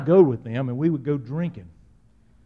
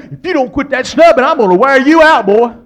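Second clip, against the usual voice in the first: loud, furious mother's scold; mama yelling at her snubbing boy; mama's threat; 4 semitones higher, 8 dB louder than usual. Retold or imitated. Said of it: "She says".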